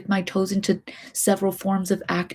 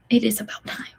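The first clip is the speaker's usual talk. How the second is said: hushed muttered resolve; whispered; 3 semitones higher, faster than usual. Real, not acted. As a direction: whisper it to convince yourself. Whispering self-encouragement.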